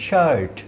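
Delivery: শার্ট with a long আ from the throat; long throat vowel. Shown as a demonstration. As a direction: stretch the আ in শার্ট. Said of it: "উচ্চারণটা হচ্ছে".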